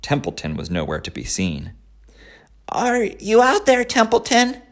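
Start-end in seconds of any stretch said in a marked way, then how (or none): 2.7-4.6 high piglet voice calling out. Acted. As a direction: call out for a friend. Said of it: "called Wilbur".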